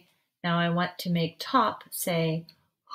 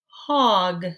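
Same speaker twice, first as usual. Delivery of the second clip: normal talking voice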